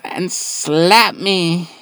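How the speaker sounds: high, drawn-out mock plea; imitated begging girlfriend. Girlfriend asks to be slapped, high slow mock plea. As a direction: stretch it out high, hammed up. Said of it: "my favorite was".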